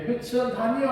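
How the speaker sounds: high rising jeer; retold outcry. Paul crying out before the priests, jeering. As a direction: cry it out again. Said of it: "소리치고 있는".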